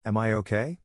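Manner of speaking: usual speaking voice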